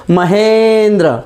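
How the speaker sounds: महेंद्र said slowly, syllable by syllable; महेंद्र, slow and clear; slow careful pronunciation. Shown as a demonstration. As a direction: draw out महेंद्र, stressing the ए. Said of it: "आप शब्द को pronounce करके देखिए".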